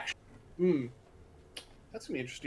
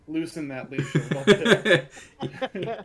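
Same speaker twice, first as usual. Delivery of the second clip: laughing; 4 semitones higher, 13 dB louder, much slower than usual. Real, not acted. Laughing about Nightbot.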